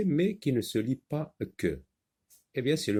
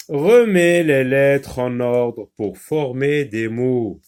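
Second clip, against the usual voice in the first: loud instruction; 3 semitones higher, 8 dB louder, slower than usual. Acted. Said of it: "reads the task aloud".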